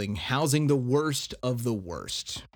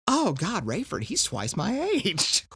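mock dismay; 8 semitones higher than usual. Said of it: "her immediate thought, his response is".

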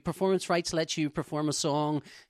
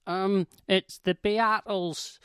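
slow answer; 3 semitones higher, much slower than usual. Retold or imitated. Sketch character answering slowly.

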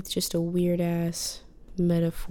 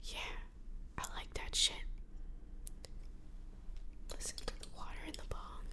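hushed late-night whisper; 12 dB quieter than usual. Real, not acted. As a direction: keep it all in a breathy whisper. Whispered podcast throughout.

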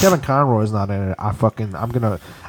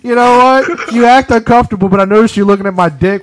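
mock teasing voice; 10 semitones higher, 5 dB louder than usual.

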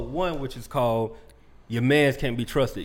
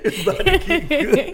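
hyped up; 13 semitones higher, 6 dB louder than usual. Acted. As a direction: stretch it out, teasing and excited. Repeats the hype.

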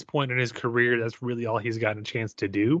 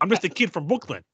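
put-on Italian Goomba accent; 5 semitones higher than usual. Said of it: "The thick Goodfellas Italian Goomba accent".

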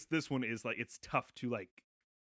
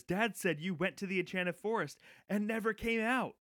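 Richard to uncle; 9 semitones higher, 4 dB louder than usual. Retold or imitated. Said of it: "where he says".

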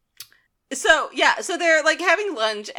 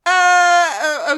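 acted hesitation; 6 semitones higher, 7 dB louder than usual. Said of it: "and he's like".

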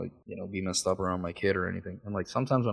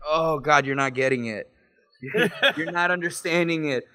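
raised, laughing exasperation; retold exasperation; 6 semitones higher, 3 dB louder than usual. Veteran to the dude who misses his point, mock exasperation.

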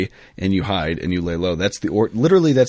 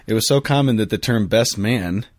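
normal talking voice

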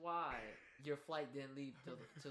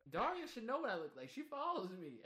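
higher, louder incredulous protest; exasperated; 8 semitones higher, 4 dB louder than usual. Retold or imitated. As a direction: protest in disbelief, pitched up. Retold confused protest.

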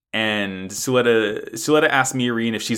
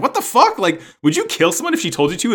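high, loud what the fuck in disbelief; shocked outburst; 10 semitones higher, 3 dB louder, faster than usual. Retold or imitated. Mirin reacting to Suletta, shrieking in disbelief. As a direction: yelp it in horrified shock. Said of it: "And Mirin's like".

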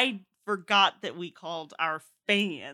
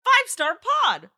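excited review read; 11 semitones higher, 8 dB louder than usual. Retold or imitated. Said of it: "Ninja in a Bucket said".

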